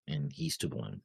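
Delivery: ordinary delivery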